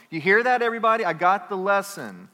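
hammed-up boast to the angels; loudly declaring to the angels; mock proud announcement. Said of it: "angels with swords going".

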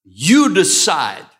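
loud, slow and deliberate; emphatic declaration. Real, not acted. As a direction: drive the point home hard. Preacher's emphatic point.

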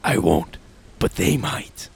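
low, quiet, cool threat; cool menacing hero. Acted. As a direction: drop it softly and coldly. Agent J's cool threat.

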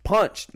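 "Punched" hit high and loud; emphatic repeat. Real, not acted. Telling friends about dad's punch, hitting "Punched" hard. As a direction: land "Punched" hard, like a blow.

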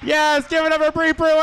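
high, loud announcer shout; rally the crowd to applaud the comic; real hyped-up stage introduction; host's applause call. Real, not acted. Host calls for applause.

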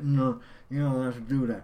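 badly faked movie-star impression. Quoting Batman's reply to a board member, shaky impression. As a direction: put on a poor imitation of the actor.

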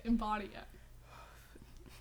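slow whisper, soft and breathy. Shown as a demonstration. Coaching her friend, slow soft whisper. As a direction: breathe it out slowly as a mystic urging.

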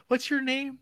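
a leering man's falsetto-ish ask; creepy high stranger voice. Retold or imitated. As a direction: play the stranger as sinisterly chirpy. Man asks her name.

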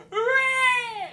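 very high, very loud whooping yell; full-volume mock scream; imitated running scream. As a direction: shriek it like someone charging along at full tilt. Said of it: "he's just running along screaming".

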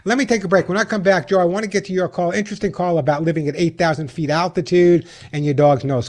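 ordinary talk, nothing marked

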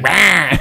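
high, drawn-out mock "bah"; imitated old lady's bleat. Old lady answers his scolding, imitated high bleat. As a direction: imitate a wordless weird noise back. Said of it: "and she's like".

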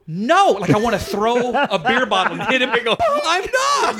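high laughing protest; mock protest. Comic dreading an overblown intro, high mock protest. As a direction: squeal out the denial. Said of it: "I'm going".

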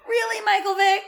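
high, disbelieving sketch catchphrase; mock incredulity. Imitating the Weekend Update bit, in high mock incredulity. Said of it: "Seth Meyers and Amy Poehler did together".

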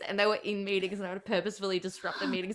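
normal talking voice

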